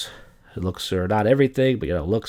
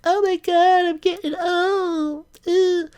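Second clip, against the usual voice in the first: put-on very high, whiny girly falsetto; mock upset girl; slower than usual. Mocking girls who panic at twenty-eight, in a high whiny falsetto. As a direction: ham up a girl fretting about her age. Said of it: "they start crying and shit".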